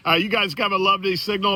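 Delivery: ordinary delivery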